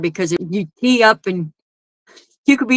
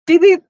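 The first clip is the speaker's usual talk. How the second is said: high, loud chirpy beep beep; mimicking phone beep; 9 semitones higher, 6 dB louder than usual. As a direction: chirp it like a Nextel.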